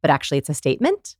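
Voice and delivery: statement ending upward on "statement"; question inflection. Shown as a demonstration. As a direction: lift "statement" as if asking. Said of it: "go up at the end of my sentence".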